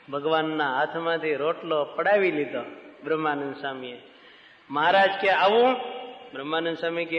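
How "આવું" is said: higher, louder startled question; surprised. Acted. Maharaj startled when the swami grabs the bread, surprised. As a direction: caught off guard.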